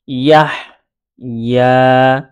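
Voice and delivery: ইয়াহ, a clear pause, then long ইয়া; separated syllable chant; letters read separately. Shown as a demonstration. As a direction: sound out ইয়াহ and ইয়া apart. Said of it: "আমি দুইভাবে পড়ে".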